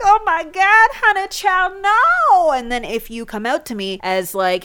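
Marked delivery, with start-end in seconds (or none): 0.0-2.5 put-on high, loud, diva-style "honey child, no"; sassy shocked mom. Mom refusing her steak-eating kid, high sassy outrage. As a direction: clutch your pearls and shut it down, high and loud. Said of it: "And I'd be like".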